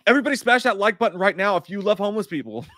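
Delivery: mock YouTuber outro. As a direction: do a cheesy YouTuber outro, tongue in cheek.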